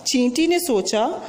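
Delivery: ordinary talk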